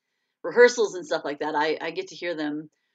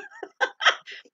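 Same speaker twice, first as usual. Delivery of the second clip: very high giggle; laughing. Real, not acted. Host giggling as she praises fellow authors' books. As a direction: break into high-pitched giggles.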